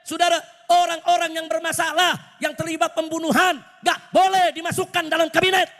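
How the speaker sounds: high-pitched rally oratory, building to a shouted takbir; impassioned preaching. Real, not acted. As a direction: drive each demand home to the crowd. Preaches demands at full force.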